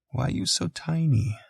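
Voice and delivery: put-on high, soft baby-talk question; gentle. A narrator's reading. Cooing to a tiny crying newborn, gentle and soft. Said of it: "I asked him gently".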